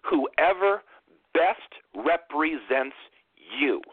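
drawn out slowly, stressing represents; slow emphatic. Shown as a demonstration. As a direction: spell out "best represents" word by word. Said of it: "I'm gonna say that last part one more time".

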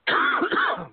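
sudden harsh coughing fit; choking cough. Real, not acted. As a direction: cough hard like something went down wrong. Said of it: "I'm choking or something over here".

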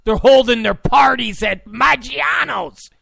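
loud, high, outraged. Real, not acted. Ranting to listeners about the party venue, mock outrage. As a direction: shout the venue like it's absurd.

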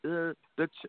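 normal talking voice

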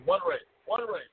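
usual speaking voice

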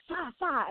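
high, soft imitated strikes; scorpion sting noise. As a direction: mimic stinging jabs, high and light. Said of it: "right, is like".

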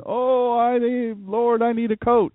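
high whiny plea for a coat; whining.

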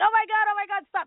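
high-pitched pained wail, played for comedy; imitating crying through a painful stretch; mock crying. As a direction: beg her to stop in a hammed-up high cry. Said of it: "and I'd be crying".

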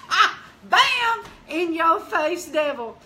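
high, loud burst of triumph; excited shouting. Retold or imitated. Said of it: "I got so excited".

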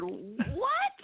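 high astonished what; high rising stunned what. Retold or imitated. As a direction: react in disbelief. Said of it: "I said".

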